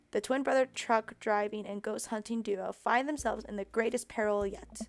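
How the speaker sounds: ordinary talk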